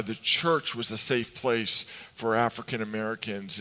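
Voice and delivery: ordinary talk, nothing marked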